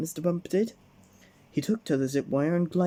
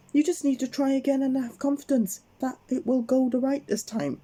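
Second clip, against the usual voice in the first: encouraging call; 11 semitones higher than usual. Acted. Mister Bump urging Miss Brave to keep climbing, raised. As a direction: keep calling up to her. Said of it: "shouted Mister Bump".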